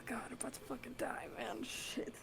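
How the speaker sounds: real tense whisper. Real, not acted. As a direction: breathe the words out in fear. Character about to be bitten.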